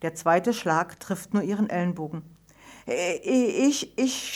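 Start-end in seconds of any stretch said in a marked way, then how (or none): 2.9-4.3 stammering and afraid, words catching; stuttering. A narrator's reading. Ida to her angry father, a frightened stutter. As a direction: stumble over the first word in fear. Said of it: "stottert sie".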